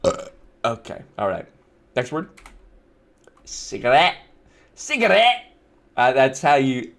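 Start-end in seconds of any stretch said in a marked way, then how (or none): 3.4-4.2 low-pitched "Cigarette" with rising end; trying out pronunciation. Shown as a demonstration. Testing how you say the word, low-pitched try. 4.8-5.5 high-pitched "Cigarette" with rising end; exaggerated pronunciation. Shown as a demonstration. Testing how you say the word, high exaggerated try. Trying out the word two ways.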